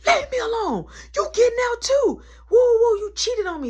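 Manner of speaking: high, loud yelling; furious accusation. Retold or imitated. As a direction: yell that he cheated. Tracy yells at narrator.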